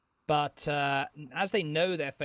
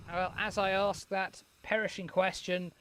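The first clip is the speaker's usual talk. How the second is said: ordinary delivery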